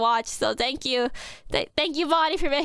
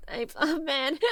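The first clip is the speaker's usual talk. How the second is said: crying; 8 semitones higher, slower than usual. Real, not acted. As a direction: let the tears take the words. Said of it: "I don't know why I'm crying".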